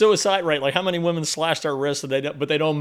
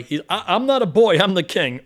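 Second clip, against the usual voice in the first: higher, louder protest; indignant young prince; 4 semitones higher, 7 dB louder than usual. Retold or imitated. The son protesting to his father, loud and indignant. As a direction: insist you're not a boy.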